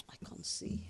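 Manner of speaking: near-whispered aside to oneself; very soft, real aside; quiet aside. Real, not acted. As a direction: say it under the breath, almost voiceless. Aside while finding the text.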